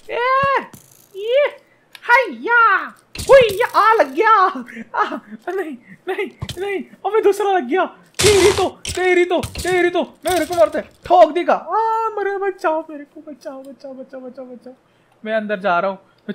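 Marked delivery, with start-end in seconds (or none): 0.1-4.3 high loud war whoops; battle cry. Real, not acted. 5.0-13.3 high panicked yelling. Real, not acted. Panicked while being shot. 13.3-14.7 mock cry for help. Fading cries for help.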